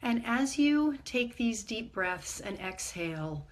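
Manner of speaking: calm, measured meditation guide with long pauses; guided meditation. Acted. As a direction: ease listeners gently inward, leaving space between phrases.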